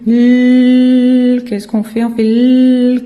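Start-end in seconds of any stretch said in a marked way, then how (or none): 0.1-1.4 "L" as a long held "lll", no vowel; first sound only. Shown as a demonstration. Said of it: "on dit que le début". 2.2-3.0 "L" as a long loud "lll"; first sound only. Shown as a demonstration. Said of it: "on dit que le début".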